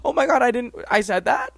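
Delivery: high-pitched retold "who, me?" reaction; imitated surprise. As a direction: act out someone astonished at their own words. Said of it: "they were like".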